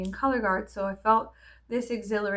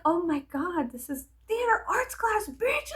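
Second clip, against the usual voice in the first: put-on much higher, outraged teen voice; indignant; 9 semitones higher than usual. Teen mouthing off to a strict teacher, high indignant. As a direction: sass the teacher back. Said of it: "I was like".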